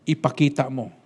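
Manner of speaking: normal talking voice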